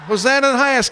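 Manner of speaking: high, loud cry of praise; joyful shout. Real, not acted. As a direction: call it out to the crowd. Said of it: "Again, let's go".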